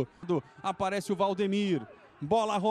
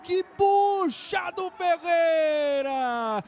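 goal shout; 5 semitones higher, much slower than usual. Real, not acted. Goal celebration, long held shout.

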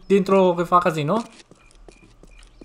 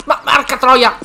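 explosive cursing; 7 semitones higher, 5 dB louder, slower than usual. Real, not acted. Swearing at the game.

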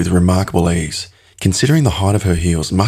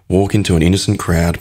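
plain reading voice

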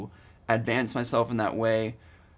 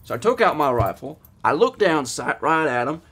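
lightly put-on Southern accent, getting louder; 6 dB louder than usual. Accent time for viewers, a bear tale in a mock Southern accent. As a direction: tell the bear story in a hammed-up Southern accent. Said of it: "I was going for Southern".

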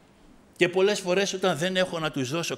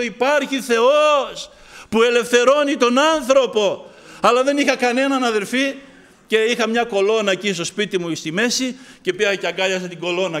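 preaching at full force; 3 semitones higher, 6 dB louder than usual. Acted. Preacher crying out his joy to the congregation. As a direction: cry out that God lives.

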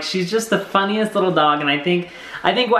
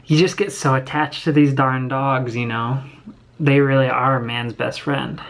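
emotional; 5 semitones lower than usual. Real, not acted. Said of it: "I'll get emotional".

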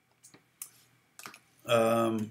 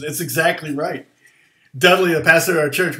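ordinary talk

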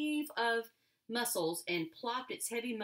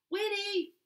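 a firm, loud, high call of a name; a mother calling her daughter in from the window, firm and loud; 13 semitones higher, 6 dB louder than usual. A narrator's reading. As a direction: summon her sharply from the house. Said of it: "came the firm voice from the window".

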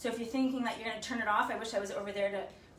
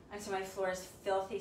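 ordinary talk, nothing marked

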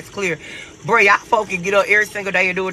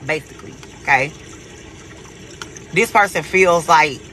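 ordinary talk

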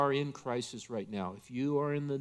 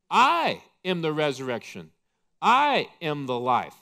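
proclaiming loudly; 6 dB louder than usual. Acted. Jesus loudly declaring himself to Martha, proclaiming. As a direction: ring it out louder, each I hit hard.

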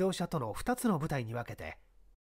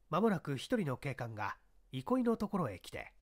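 ordinary delivery, nothing marked

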